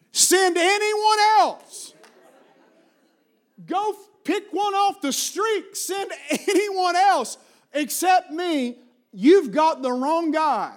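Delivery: high, slow, stretched-out begging; desperate pleading. Acted. Moses begs God to send anyone else, desperate plea.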